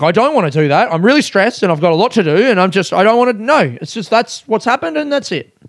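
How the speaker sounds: rising, pleading whine of someone overwhelmed; mock-stressed woman refusing to budge; stressed-out booking agent. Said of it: "And she's like".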